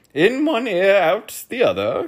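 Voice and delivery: high, loud duke's reproof; scolding. A narrator's reading. Duke scolding the earl at the table, high and loud. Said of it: "scolded the duke".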